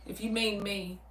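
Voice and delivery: ordinary talk, nothing marked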